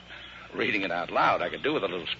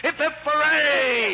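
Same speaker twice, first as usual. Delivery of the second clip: much higher, louder, slow hip hip hooray; triumphant cheer; 5 dB louder, slower than usual. Acted. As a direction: belt out the cheer. Celebratory cheer.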